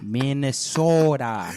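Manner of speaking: very slow, exaggerated Minnesota accent; mock Minnesotan, long drawn O. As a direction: stretch it out like a hammy Minnesotan. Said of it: "That's a tough accent".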